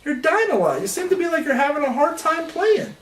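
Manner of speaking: high-pitched, patronizing helper voice; condescending game prompt. Retold or imitated. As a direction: pipe up as an over-helpful video game. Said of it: "the game pops up and it goes".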